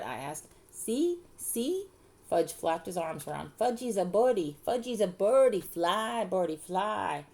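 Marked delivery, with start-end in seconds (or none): 0.7-1.9 put-on very high-pitched little boy; toddler voice. A narrator's reading. Toddler showing off from jungle gym. 3.6-7.2 put-on high, loud little-boy crowing; excited toddler. A narrator's reading. Toddler playing bird, flapping arms.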